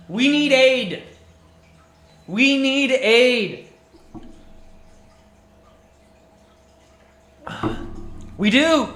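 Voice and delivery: loud, high, slow pleading war cry; rally the kingdom as if Gondor must answer; hammed-up epic plea for aid; mock call to arms.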